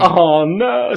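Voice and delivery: high, drawn-out retold "oh no"; dismayed outburst. Hunter recalling the click of his half-pumped gun, dismayed. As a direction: replay the sinking realisation, voice lifted.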